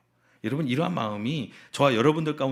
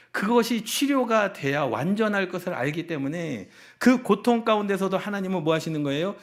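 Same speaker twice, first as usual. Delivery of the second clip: loud, high-pitched sermon delivery; impassioned preaching; 7 semitones higher, 6 dB louder than usual. Real, not acted. Pastor tells congregation God is present in suffering, impassioned preaching. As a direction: preach it out with full conviction.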